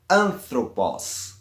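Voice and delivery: anthropos slowly, first syllable AN stressed. Shown as a demonstration. Teacher presenting a word with short last syllable, anthropos stressed on AN. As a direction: put the accent on the AN of anthropos. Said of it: "o acento ele vai cair na antepenúltima".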